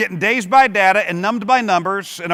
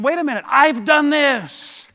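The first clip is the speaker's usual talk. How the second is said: slowed, higher mock realization; sudden realization; 6 semitones higher, slower than usual. The sales manager stops himself mid-rant, slow dawning realization. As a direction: catch yourself mid-thought, slowly. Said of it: "I had to get a hold of myself because I said".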